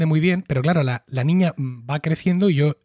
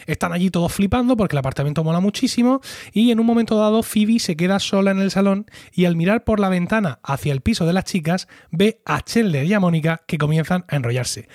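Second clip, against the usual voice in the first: usual speaking voice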